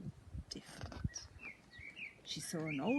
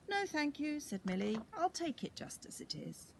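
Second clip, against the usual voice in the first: put-on high, cheerful girl voice; happy little girl; 8 semitones higher than usual. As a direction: chirp it like a pleased child. Millie happy with hat.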